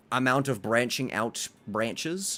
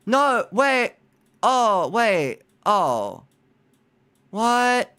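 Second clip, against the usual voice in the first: loud, high exclamations; high flustered disbelief; dismayed surprise; 9 semitones higher, 7 dB louder, much slower than usual. Real, not acted. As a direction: cry out in bewildered realisation. Realising mistake in game.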